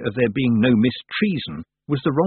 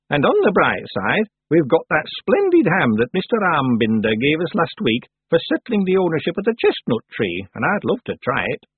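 higher-pitched witch character; old witch, pleased; 6 semitones higher, 3 dB louder than usual. Acted. Old witch relishes the ham.

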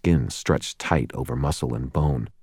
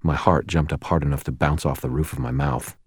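usual speaking voice

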